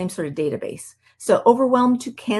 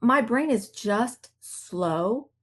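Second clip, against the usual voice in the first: therapist's slow calm; much slower than usual. Retold or imitated. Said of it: "And she says".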